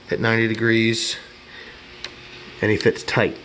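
usual speaking voice

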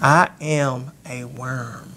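humbled lament. Retold or imitated. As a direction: let the words sink, slow and humbled. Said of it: "Christ said that".

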